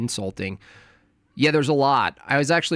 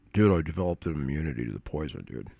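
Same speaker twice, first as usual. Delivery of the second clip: mock movie villain; 9 semitones lower, 4 dB quieter, much faster than usual. Mocking Princess Bride poison scene.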